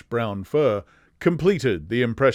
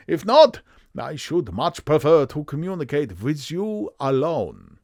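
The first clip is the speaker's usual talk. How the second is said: emphatic German nobleman, swooping pitch; strong German accent; 5 semitones higher than usual. Acted. Bohemian count introducing himself, strong German accent. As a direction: declaim it with a heavy German accent. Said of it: "a strongly marked German accent".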